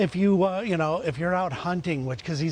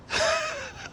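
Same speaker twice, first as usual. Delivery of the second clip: burst of laughter. Real, not acted. Friend calls him dude and bitch.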